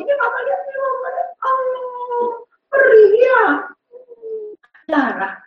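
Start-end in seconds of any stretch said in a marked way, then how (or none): 0.0-3.4 high, cooing mother voice; high-pitched motherly sympathy; actively listening mother. Shown as a demonstration. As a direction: coo high with sympathy to a hurt child. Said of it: "untuk mendengar aktif".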